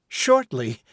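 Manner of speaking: high, sharp demand; petulant retort. A narrator's reading. Woman retorts to the mending committee, petulantly. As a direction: snap back impatiently. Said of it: "she retorted".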